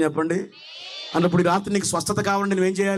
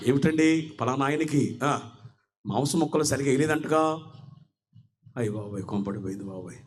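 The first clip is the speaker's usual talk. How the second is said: mock dismay; 9 semitones lower, 6 dB quieter than usual.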